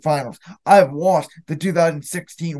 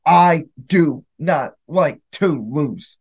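ordinary talk, nothing marked

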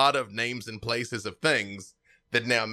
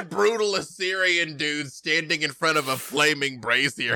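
normal talking voice

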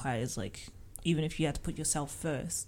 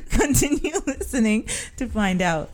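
laughing, high and loud; mock radio sign-off, giggling; laughing announcer; 7 semitones higher, 11 dB louder than usual.

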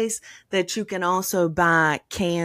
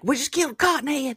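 put-on high, quick excited kid voice; yelling little boys; 9 semitones higher, faster than usual. Random kids yelling news in the yard, high-pitched imitation. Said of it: "just coming up yelling things like".

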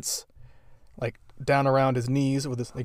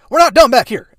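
very high, very loud mock yell; mock shout; 12 semitones higher, 14 dB louder than usual. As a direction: holler it like a caught couple. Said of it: "they're just like".

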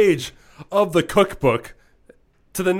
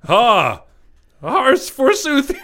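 hammy stage actor; 4 dB louder, slower than usual. Acted. Mock olde English proclamation.

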